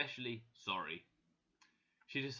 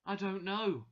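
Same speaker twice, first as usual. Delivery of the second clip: higher, louder protest; 7 semitones higher, 5 dB louder than usual. A narrator's reading. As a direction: protest helplessly against the accusation. Accused Anna protests to employer.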